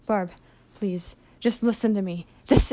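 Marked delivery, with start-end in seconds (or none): none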